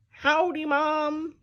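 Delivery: high, loud holler. A narrator's reading. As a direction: holler it up the stairs. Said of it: "and hollered".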